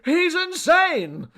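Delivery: high, drawn-out alarmed scream. A narrator's reading. Rival fleeing the man, screaming. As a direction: shriek it in panic. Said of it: "screamed McAllister".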